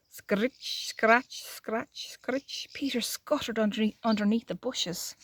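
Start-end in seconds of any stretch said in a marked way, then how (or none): 0.1-2.7 soft, partly whispered scratching sounds; hoe noise. Acted. Storyteller imitating the gardener's hoe, hushed and scratchy. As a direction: imitate a hoe scraping the earth. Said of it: "the noise of a hoe".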